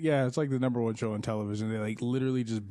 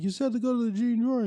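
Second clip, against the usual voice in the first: much higher, louder protesting voice; high indignant protest; 14 semitones higher, 5 dB louder than usual. Retold or imitated. Man protesting to the CEO about the green door, high and indignant. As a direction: whine it back in defense.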